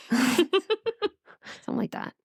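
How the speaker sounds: words squeezed out through laughter; giggling aside; laughing. Real, not acted. Laughing after demo.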